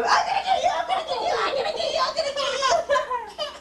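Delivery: sing-song I'm gonna get you through giggles; mother tickling her toddler, high laughing playful threat; playful tickle chase. Pretend.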